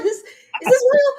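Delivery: laughing, shouting it out high; a laughing yell of disbelief. Retold or imitated. To the caller, a laughing shouted is this real. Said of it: "I was like".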